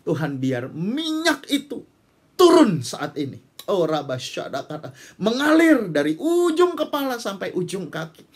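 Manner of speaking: fervent tongues prayer leading the church, building to full force. Acted. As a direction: pray over them in tongues, rising to a cry. (doa bahasa roh yang berapi-api, makin lama makin keras)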